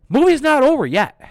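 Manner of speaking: high, loud, emphatic announcement; loud exclamation. Real, not acted. Reviewer telling viewers the film goes on, loudly emphatic. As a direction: belt it out high and hard.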